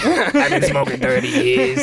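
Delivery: high, slow hammed-up smoker ad voice; mock anti-smoking commercial victim. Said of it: "I feel like one of those commercials".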